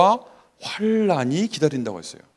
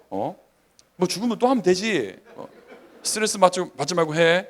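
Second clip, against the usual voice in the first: acted-out exasperated dad, pitched up; raised-voice parental reasoning, played for laughs; father's nagging lecture; 5 semitones higher, 5 dB louder than usual. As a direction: lecture a gamer son, pressing the point. Father re-enacts lecturing his gamer son.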